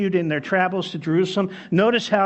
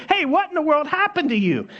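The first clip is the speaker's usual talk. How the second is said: surprised friend; 9 semitones higher than usual. Retold or imitated. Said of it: "So his friend said".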